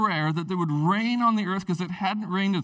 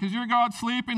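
taunting; 5 semitones higher than usual. Retold or imitated. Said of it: "he's just taunting them all day".

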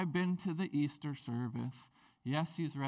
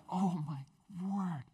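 slow, hushed pain; a hurt kid's slow oh my word; pained groan; much slower than usual. Retold or imitated. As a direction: wince through the words. Feeling the road rash pain.

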